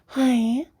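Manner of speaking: high, drawn-out tuner "Hi". Shown as a demonstration. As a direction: pitch the greeting up high. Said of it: "it's always higher than what I'm doing".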